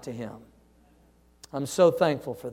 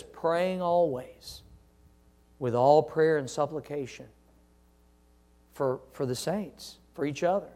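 soft scripture quote; 3 semitones lower, 8 dB quieter than usual. Acted. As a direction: read it gently, almost hushed. Quoting Ephesians softly.

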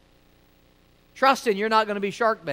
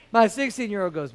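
normal talking voice

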